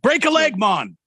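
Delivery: mock Jamaican accent. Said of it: "the Jamaican accent".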